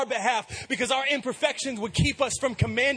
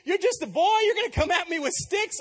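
put-on the giant's voice, higher and louder, scoffing at a boy; mocking giant; 10 semitones higher, 4 dB louder than usual. A giant taunting young David, higher and scornful. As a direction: sneer down at a little kid like a giant. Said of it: "He's like".

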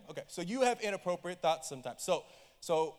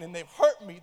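ordinary talk

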